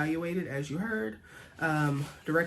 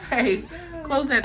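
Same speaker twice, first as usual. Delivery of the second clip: firm warning; 9 semitones higher, 6 dB louder, slower than usual. Real, not acted. Mom scolding toddler.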